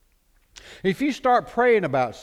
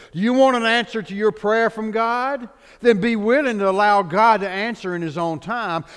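louder, emphatic pulpit exhortation; preaching; 6 semitones higher, 6 dB louder than usual. Acted. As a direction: drive each point home. Preaching points with force.

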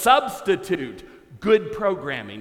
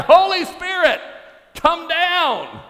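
even louder, higher shout; crying out louder; 11 semitones higher, 7 dB louder, slower than usual. Retold or imitated. Pastor calls the dove down, shouting louder. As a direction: call up to the rafters, louder. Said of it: "he cries out even louder".

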